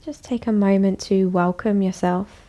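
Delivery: calm, even voice with long pauses; guided meditation. Acted. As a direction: guide them gently inward, leaving space after each phrase. Leads a bedtime meditation.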